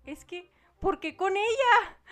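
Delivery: agudo y lento, con la voz quebrada; reclamo lloroso. Retold or imitated. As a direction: suplica dolida por qué ella. Sueño, reclamo llorando al ex. (reclamo lloroso y agudo)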